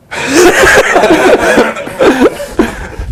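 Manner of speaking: real high cracking laugh; high burst of laughter. Real, not acted. Retelling the movie scene, a high helpless laugh.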